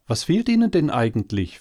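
hohe, schnelle, besorgte Äffchenstimme; teilnehmendes Äffchen. A narrator's reading. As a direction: frag flink und mitfühlend nach. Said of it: "fragte das Äffchen teilnehmend". (kleines Äffchen, hoch, schnell und teilnehmend)

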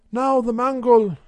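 a lightly voiced local carter; carter's voice. A narrator's reading.

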